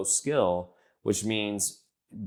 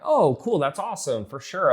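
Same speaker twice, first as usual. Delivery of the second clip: easygoing approval; 7 semitones higher than usual. Acted. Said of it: "I'm like".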